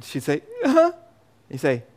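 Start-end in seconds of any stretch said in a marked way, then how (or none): 0.6-0.9 put-on little girl reply. Little daughter answering dad.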